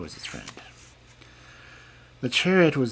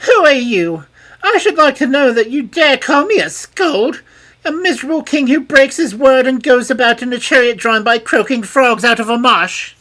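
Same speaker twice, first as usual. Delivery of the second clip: much higher, louder raging tirade; raging princess; 12 dB louder than usual. A narrator's reading. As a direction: berate the king. Princess insults the king.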